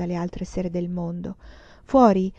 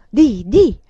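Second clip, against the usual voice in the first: louder, harder prompt; forceful urging; 3 semitones higher, 9 dB louder than usual. A narrator's reading. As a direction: force her to say everything. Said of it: "sempre con forza crescente".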